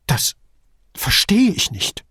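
halting, high-pitched bewilderment; mildly stammered disbelief; stammering. A narrator's reading. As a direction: falter after the first word, confused. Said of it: "stammelte Duso".

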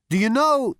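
high, very loud; shouting. A narrator's reading. Zaphod warning the man over the bombing, high shout. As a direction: shout right into someone's ear. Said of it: "Zaphod shouted in his ear".

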